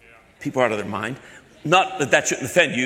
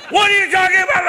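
very high, loud scammer scream; hammed-up outraged phone yell; imitated screaming; 8 dB louder than usual. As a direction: scream it, utterly exasperated. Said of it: "I'm screaming".

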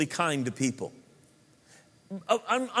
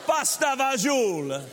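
put-on Italian accent; 7 semitones higher than usual. Preacher teasing English food, answering with a hammed-up Italian accent. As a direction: savor it like an Italian cook. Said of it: "Shepherd pie, really?".

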